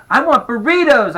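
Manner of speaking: high, loud rival demand; shouting to outdo. A narrator's reading. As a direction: shout it to drown out your brother. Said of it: "I shouted louder".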